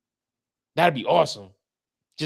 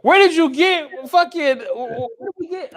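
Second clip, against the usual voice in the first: high, loud hammed-up rant; mock outrage; 14 semitones higher, 6 dB louder than usual. Reacting to Putin being from India, mock outrage. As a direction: blow up in exaggerated disbelief.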